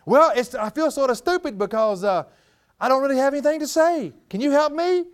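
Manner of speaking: put-on high, sheepish voice of a young man; awkward young man. Preacher voicing Robert asking him for help, high and sheepish. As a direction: pitch it up, fumbling and embarrassed.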